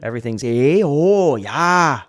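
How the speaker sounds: lightly voiced Canadian accent; a hint of Toronto relatives chatting; mild imitation of a Canadian accent; Toronto family accent. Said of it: "I always kinda channel".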